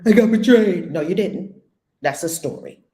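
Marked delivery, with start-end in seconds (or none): 0.0-0.9 a much louder, indignant mock complaint; mock victim cry. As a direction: mimic someone clinging to their story. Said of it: "Make up a story".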